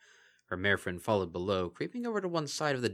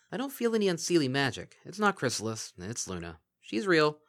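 a touch higher, firm pony voice; Bon Bon assessing; 7 semitones higher, 3 dB louder than usual. Acted. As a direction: reassure them it's safe. Bon Bon reassuring from ceiling.

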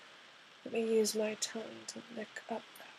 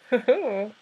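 loud laugh; 3 semitones higher, 12 dB louder than usual. Acted. Teasing during intimate scene.

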